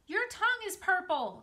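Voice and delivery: put-on high, loud child's scream. A narrator's reading. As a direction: shriek it at your brother in glee. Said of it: "screamed Fern".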